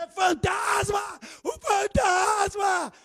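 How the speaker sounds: high, slow panicked scream of ghost; terrified shout. Retold or imitated. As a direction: shriek it like sailors seeing a ghost. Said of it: "eles começaram a gritar".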